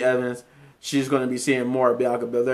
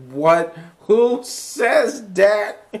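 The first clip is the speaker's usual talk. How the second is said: high, drawn-out mock disbelief; incredulous; 8 semitones higher, much slower than usual. Reacting to a wrestler's odd outburst, hammed-up incredulity. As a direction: stretch it up high in baffled amusement. Acting out own baffled reaction.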